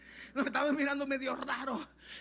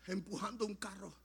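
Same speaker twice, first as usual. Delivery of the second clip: soft, grave storytelling with long pauses; hushed testimony; 2 semitones lower, 5 dB quieter than usual. Acted. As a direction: let each phrase sink in. Hushed telling of emotional encounter.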